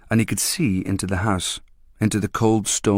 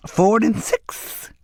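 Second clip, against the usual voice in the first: coaxing dealer; 13 semitones higher, much slower than usual. A narrator's reading. Dealer pricing.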